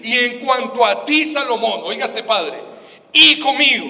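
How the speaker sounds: fiery preacher reading out David's charge to his son; passionate preaching. Acted. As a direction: press the scripture hard on the congregation.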